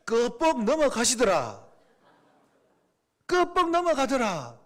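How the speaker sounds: slow playful Gyeongsang dialect, 끄뻑 stressed. Retold or imitated. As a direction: say it like a Gyeongsang local, slowly. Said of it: "경상도 사투리로 하면". (경상도 사투리로 느릿하게)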